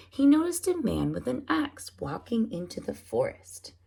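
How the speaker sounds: usual speaking voice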